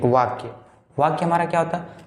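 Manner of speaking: normal talking voice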